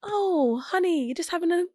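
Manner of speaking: high, sugary mock coddling. Mocking gentle talk to a crier, high and cooing. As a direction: coo it like you're mocking a babysitter.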